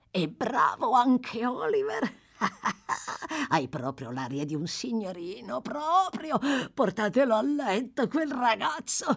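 put-on a crazed old man reliving the past. (vecchio delirante, voce acuta e lenta)

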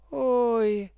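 high, whimpering moan; whining.

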